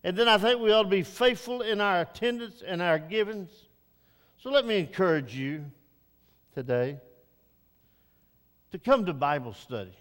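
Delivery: usual speaking voice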